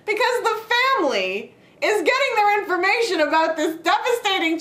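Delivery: high-pitched, exasperated movie rant; mock screaming rant. Fan reliving her outburst at the film, mock screaming. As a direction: rail in exaggerated disbelief, pitch way up. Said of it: "this is what I was screaming".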